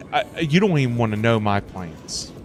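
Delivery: ordinary talk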